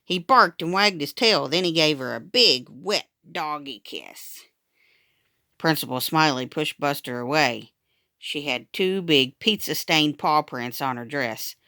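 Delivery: ordinary delivery, nothing marked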